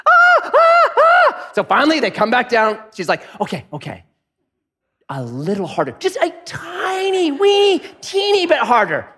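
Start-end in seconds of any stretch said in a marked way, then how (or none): 0.1-1.5 high frantic squeals of a freaked-out lady; panicked shrieks. Retold or imitated. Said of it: "the lady's freaking". 3.4-9.1 fretful coaxing lady. Retold or imitated.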